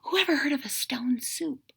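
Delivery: soft unvoiced whisper. A narrator's reading. Mayor whispering to the villagers, soft and unvoiced. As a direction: hush it like a secret. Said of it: "whispered the mayor".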